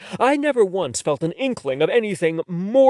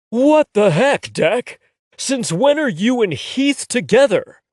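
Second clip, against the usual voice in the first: stunned, drawn-out disbelief, louder and higher; can't believe what he's just heard; incredulous; 8 semitones higher, 5 dB louder than usual. A narrator's reading. Aiden confronts his friend in disbelief, incredulous. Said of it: "with an incredulous expression".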